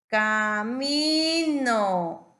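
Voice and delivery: CAMINO loud, very slowly stretched; drawn-out demonstration. Shown as a demonstration. Teacher helping a child spot the I, CAMINO stretched loud. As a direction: draw out every syllable of CAMINO, loud. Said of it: "¿Identificaste el sonido I?".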